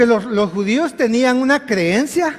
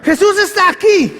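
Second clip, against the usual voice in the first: high, raised call to a sister; acted calling out; 8 semitones higher, 4 dB louder than usual. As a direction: call the news through the house. Sister calls out the news.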